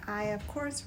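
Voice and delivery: ordinary talk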